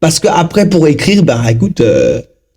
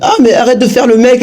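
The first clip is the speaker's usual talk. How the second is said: higher, teasing jeer at a show-off; mock the friend who sneers at your English; 10 semitones higher than usual. Friend sneers at someone speaking English, higher mocking jeer. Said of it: "quelqu'un va dire".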